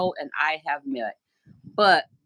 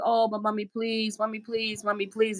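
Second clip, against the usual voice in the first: higher, softer whiny begging; pleading beggar; 5 semitones higher, 4 dB quieter than usual. Retold or imitated. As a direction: plead like a pushy street seller. Beggar pestering, voiced by speaker.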